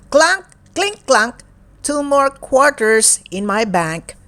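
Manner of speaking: lively, sing-song picture book reading; reading to children. Acted. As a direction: read it brightly to a young child. Picture book read aloud.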